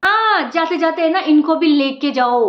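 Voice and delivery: normal talking voice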